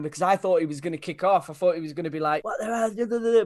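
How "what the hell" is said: high, slow what the hell; imagine the director blowing up; hammed-up indignation; mock angry outburst.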